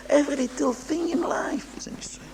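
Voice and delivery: hushed, breathy whisper of the key point. Real, not acted. Rabbi to his listeners, whispering the lesson. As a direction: drop to a whisper to land it.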